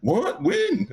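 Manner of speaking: mock surprise. Friend voiced forgetting the plan.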